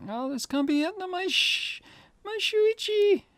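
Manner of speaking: very high, drawn-out wail; despairing girl cry. Acted. Girl crying for Shuichi as the curse hits, despairing. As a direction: cry out for a lost loved one.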